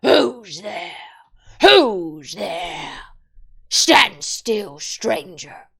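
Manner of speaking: loud growling wolf challenge; wolf guarding the door growls at Edmund. A narrator's reading. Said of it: "said in a growling voice".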